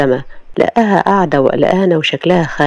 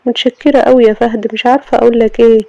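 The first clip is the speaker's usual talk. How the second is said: put-on higher, quick young woman; grateful Sama; 6 semitones higher, 3 dB louder than usual. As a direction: thank him warmly and fast.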